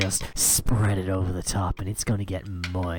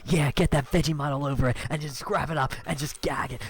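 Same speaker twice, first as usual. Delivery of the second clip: manic rush; 6 semitones higher, faster than usual. Acted. Manic climax of the parody.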